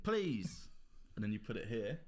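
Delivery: ordinary delivery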